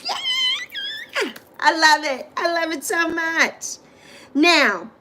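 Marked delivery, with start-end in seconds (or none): 0.0-1.3 high squealing laugh on yeah. Real, not acted. Host delighted by the birthday hats, laughing. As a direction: burst out laughing with joy.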